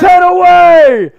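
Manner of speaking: loud, high, slow shout; excited shout. Real, not acted. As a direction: bellow it out, pumped. Countdown near sub goal.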